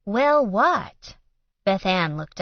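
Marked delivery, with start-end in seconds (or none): 0.1-1.2 defensive. A narrator's reading. Defensive retort.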